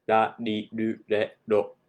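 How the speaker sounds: ra ri ru re ro with a tapped r; Japanese r syllables. Shown as a demonstration. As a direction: tap the tongue behind the teeth. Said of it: "in Japanese".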